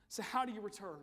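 ordinary talk

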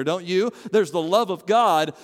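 ordinary talk

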